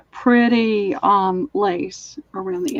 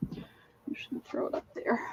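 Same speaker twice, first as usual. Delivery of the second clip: whispered aside; 15 dB quieter than usual. Real, not acted. Aside to herself while selling.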